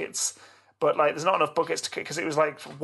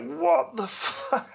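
stunned disbelief; 12 semitones higher, much slower than usual. Retold or imitated. Dad finds flooded bathroom.

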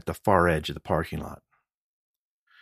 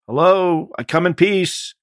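calling out; 13 semitones higher, 9 dB louder, slower than usual. Acted. Announcing himself to armed man.

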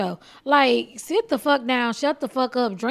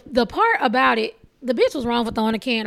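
normal talking voice

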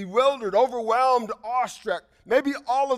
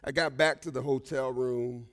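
usual speaking voice

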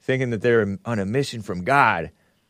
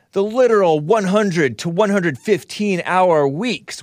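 mock exasperated read; 7 semitones higher, 4 dB louder, slower than usual. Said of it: "She goes on".

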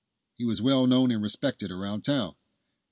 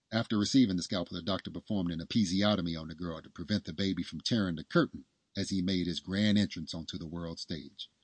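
ordinary delivery

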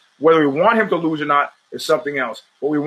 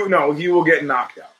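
ordinary talk, nothing marked

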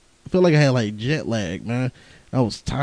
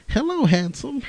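lightly imitated Jamaican accent, higher; 12 semitones higher than usual. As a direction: flirt it in a hint of a Jamaican accent. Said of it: "Jamaican accent and shit".

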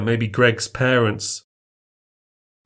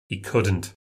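usual speaking voice

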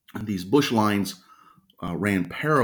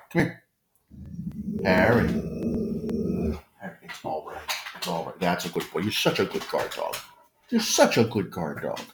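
soothing dog praise. Real, not acted. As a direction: reassure the growling dog.

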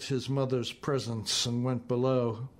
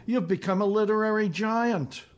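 pitched up high, a touch hysterical; a little hysterical; 8 semitones higher, 3 dB louder than usual. A narrator's reading. Old classmate gushing at the visiting writer, a little hysterical. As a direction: gush it out, slightly overwrought. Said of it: "a little hysterically".

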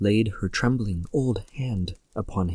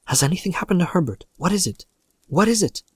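hurried, higher, anxious questions; breathless; 6 semitones higher, faster than usual. A narrator's reading. Mother to visitor about her son Herbert, breathless and anxious. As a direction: press for an answer, pitch climbing. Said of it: "she asked breathlessly".

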